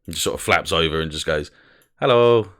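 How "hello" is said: higher, louder cheery hello; perky unit saying hello; happy robot voice. Acted. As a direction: ring out a happy little greeting. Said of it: "has a happy and sad personality".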